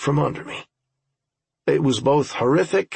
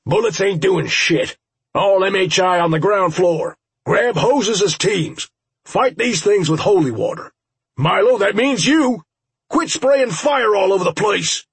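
high, raised commanding voice; shouted radio orders; 7 semitones higher, 4 dB louder than usual. Acted. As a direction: bark urgent orders over the radio. Urgent radio orders in battle.

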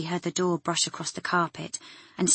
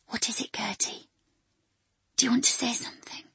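whisper; 5 semitones higher than usual. Acted. Leaning close to dying woman.

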